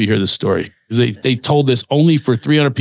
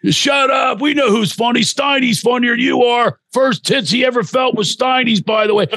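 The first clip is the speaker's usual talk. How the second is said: put-on drunk Philly friend shouting high; Philly accent taunt; 10 semitones higher than usual. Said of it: "With the Philly accents".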